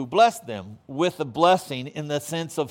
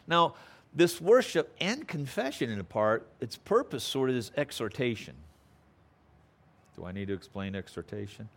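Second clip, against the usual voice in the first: ordinary delivery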